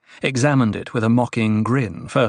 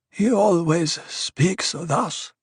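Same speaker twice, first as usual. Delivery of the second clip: high, very slow character voice; apologetic Othheim; 7 semitones higher, much slower than usual. A narrator's reading. Othheim apologizes to Paul for the dwarf, apologetic. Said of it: "Othheim apologized".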